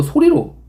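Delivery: the word 소리로 thrown out high and hard; 소리로 said sharply and high as a punchline; emphatic answer. Shown as a demonstration. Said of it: "뭘로?".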